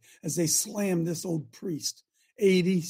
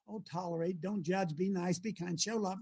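mock-gentle church talk; 2 semitones lower, 8 dB quieter than usual. Preacher imitating what churches tell you, soft and dismissive. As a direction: drop right down into a meek little list.

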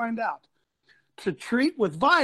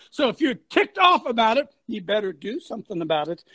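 ordinary delivery

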